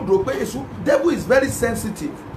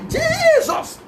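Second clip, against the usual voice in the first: crying out; much slower than usual. Shown as a demonstration. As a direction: wail it like you mean it. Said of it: "you will cry, you will call Jesus".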